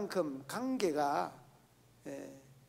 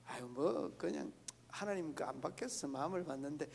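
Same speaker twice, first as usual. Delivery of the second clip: mild agreeable reply; 3 semitones lower, 10 dB quieter than usual. Retold or imitated. Modelling a mild acceptance.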